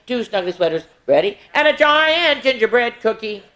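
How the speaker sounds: lively sing-song counting rhyme; reading to children. Acted. Miss Tasha reading a Christmas counting book to kids, sing-song. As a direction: read it out brightly, verse by verse for the little ones.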